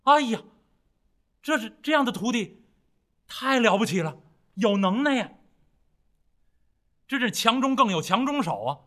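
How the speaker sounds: 响亮激动地夸徒弟; 唐僧惊叹徒弟能耐，声音又响又高; 惊喜赞叹. Acted. (兴奋赞叹，嗓门放开)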